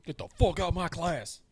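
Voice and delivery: mock furious throw-out in a put-on voice; angry order. Imagined teacher throwing the kid out, mock angry order.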